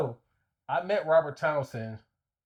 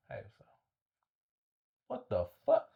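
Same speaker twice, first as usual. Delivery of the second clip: stunned disbelief; 3 semitones lower than usual. Retold or imitated. Retelling spotting Robert Townsend while eating, stunned surprise. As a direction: caught off guard on spotting a celebrity. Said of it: "and I was like".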